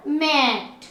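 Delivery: मैट stretched slowly on the "ai"; long sound. Shown as a demonstration. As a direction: hold the vowel in मैट. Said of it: "long sound में दे रही हूँ". (मैट में "ऐ" लंबा खींचें)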